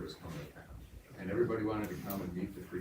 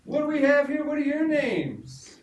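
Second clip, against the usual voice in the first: much higher, loud gushing preacher; hammed-up preacher; 7 dB louder than usual. Preacher welcoming the children at the tent, high and gushing. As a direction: sing it out like an over-sweet preacher, slowing at the end. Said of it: "He said".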